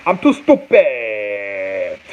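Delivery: slow, drawn-out mock wail; hammed-up helpless whine; mock despair. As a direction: stretch the last word into a long falling moan. Mock despair at failing to read.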